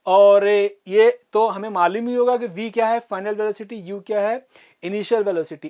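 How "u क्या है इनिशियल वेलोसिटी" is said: ordinary talk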